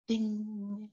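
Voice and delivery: high sung ding like a bell; mock meditation bell ding; mock chime.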